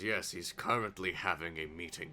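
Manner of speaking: lightly voiced guard, low and soft; low, quiet in-character guard. Guard turning visitors away, lightly voiced, low. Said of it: "the guard looks and says".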